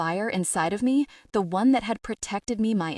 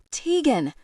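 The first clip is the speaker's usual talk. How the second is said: high, loud desperate roar; roaring her name; 7 semitones higher, 3 dB louder than usual. Acted. Noah bursts outside shouting for Tegan, loud. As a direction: yell her name at the top of your lungs.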